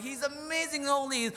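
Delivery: high mock gushing; hypothetical angel quote. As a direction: toss it out high as a playful alternative. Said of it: "Why can't he just say".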